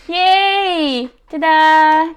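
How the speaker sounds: excited cheer. Real, not acted. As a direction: cheer the finished card to viewers. Celebrates finished piece.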